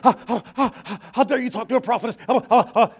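loud, high, spluttering outrage; mock-indignant stammer, loud and high; indignant prophetess. Acting out indignant lady.

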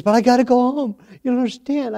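put-on high pleading voice of a teenage girl; pleading daughter. Father voicing his crying daughter, high and pleading.

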